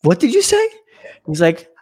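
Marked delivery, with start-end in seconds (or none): 0.0-0.7 much higher, incredulous father; a stern dad daring him to repeat it; angry father. Retold or imitated. Father demands son repeat insult, incredulous high voice.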